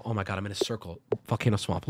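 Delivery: ordinary talk, nothing marked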